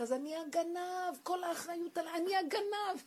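high, loud, drawn-out outcry; retold shouting. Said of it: "הוא התחיל לצעוק".

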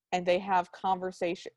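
ordinary talk

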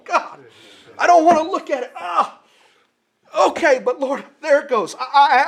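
high, loud, pleading cries to God; anguished repentant prayer. Acted. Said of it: "Here's what change looks like".

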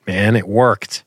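ordinary delivery

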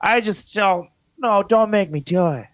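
high-pitched imitation of a pleading daughter; mock child begging, hammed-up; little drama actress. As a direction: plead like a theatrical little girl. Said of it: "she's an actress".

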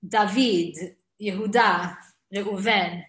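"David", "Yehuda", "Reuven", each stressed on its last syllable; names stressed at end. Shown as a demonstration. Teacher listing names, "David", "Yehuda", "Reuven" stressed at the end. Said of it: "you emphasize the end of the syllable".

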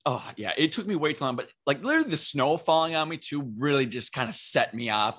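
normal talking voice

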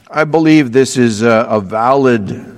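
ordinary talk, nothing marked

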